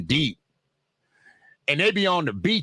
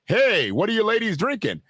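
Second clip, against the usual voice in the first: higher-pitched mock moneyed older man; 6 semitones higher than usual. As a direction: put on a rich old guy chatting up women.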